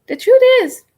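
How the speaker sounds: the truth is, said high and loud with force; emphatic. Shown as a demonstration. Demonstrating EFT tapping to viewers, high and loud. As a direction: proclaim it boldly. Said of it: "so you can just go".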